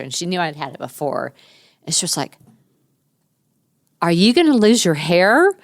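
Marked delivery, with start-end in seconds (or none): 4.0-5.5 put-on high, loud, worried child's question; daughter's anxious question about losing hair; little girl voice. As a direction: ask it like a small girl fretting. Six-year-old daughter quoted.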